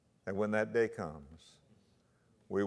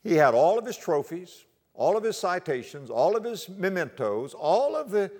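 louder, higher, hammering each "all"; preaching at full force; 7 semitones higher, 8 dB louder than usual. Acted. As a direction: build the list like a sermon. Preacher building a list.

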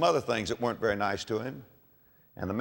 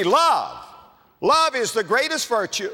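ordinary talk

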